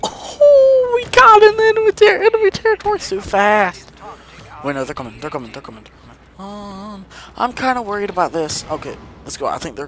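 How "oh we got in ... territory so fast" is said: very high excited squeal. Real, not acted. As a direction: squeal with surprise.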